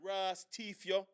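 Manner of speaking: muttered mock Jamaican grumble; soft, disgusted Jamaican aside, hammed up; Jamaican accent impression. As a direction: grumble under your breath in a put-on Jamaican accent. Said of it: "it's like Italian versus Jamaican".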